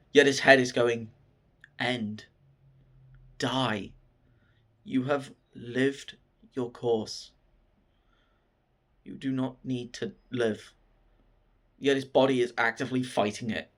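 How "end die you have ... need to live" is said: quiet, slow, spaced-out commands; inner voice. Retold or imitated. His own head telling him to give up, quiet inner voice.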